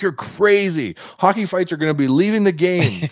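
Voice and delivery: high, loud imitation of naysayers; mock incredulous outcry. Everyone dismissing the plan to buy Hockey Fights, mock incredulous outcry. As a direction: exclaim it like a scoffing crowd. Acted objection of everyone to purchase.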